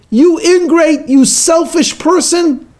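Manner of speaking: high, loud, furious shout; angry shout. Acted. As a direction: yell it at her in sudden rage. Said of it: "suddenly Anton shouts at her".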